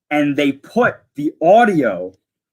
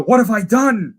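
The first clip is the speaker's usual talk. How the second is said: high-pitched mock anguish; imitating Anakin; 6 semitones higher than usual. Anakin as he turns to the dark side, imitated high anguish. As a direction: act out a horrified realisation, pitched up. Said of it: "you hear Anakin go".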